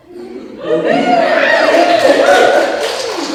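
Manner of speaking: high, loud burst of laughter. Real, not acted. Singer remembering her old band, bursting into loud laughter. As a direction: break into delighted laughter.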